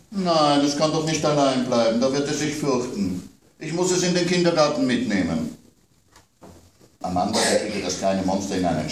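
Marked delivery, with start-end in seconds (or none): 0.1-5.6 put-on higher, childlike complaint; child's voice worried about monsters at bedtime; little girl. A narrator's reading. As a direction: play the small daughter scared of monsters. Child Amanda protests.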